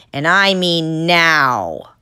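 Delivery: loud, slow, every word pressed; slow angry yell. Acted. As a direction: threaten them, slow and hard. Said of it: "the guard yelled".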